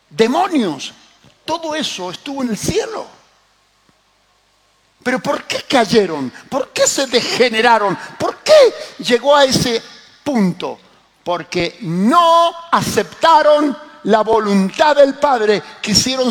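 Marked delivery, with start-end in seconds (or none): 5.0-16.3 emphatic preaching, building in force; prédica enérgica. Acted. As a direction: drive each question home like a fired-up preacher. Predica con fuerza creciente. (predicando con fuerza creciente)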